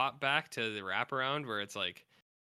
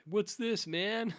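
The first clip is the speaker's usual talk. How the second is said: surfer dude; 11 semitones higher, much slower than usual. Retold or imitated. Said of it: "and they're like".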